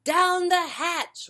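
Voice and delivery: the crew shouting back at dinner. Acted. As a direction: roar it back as the whole crew. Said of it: "we all shouted".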